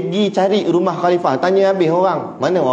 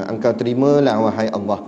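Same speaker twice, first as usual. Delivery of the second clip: humble prayer; 6 semitones lower than usual. Shown as a demonstration. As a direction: let the plea fall away humbly. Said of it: "ni cara Nabi berdoa".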